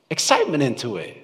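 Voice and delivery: ordinary talk, nothing marked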